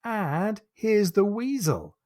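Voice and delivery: put-on high, slow child's call; a seven-year-old ship's boy calling out from the yard arm; child crying out. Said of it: "The child cries".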